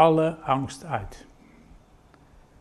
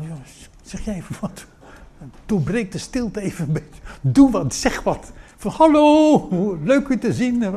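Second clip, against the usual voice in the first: awkward disciple; 8 semitones higher, 4 dB louder than usual. Acted. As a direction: play an embarrassed disciple pushing a friend to speak. Disciples nudging each other awkwardly.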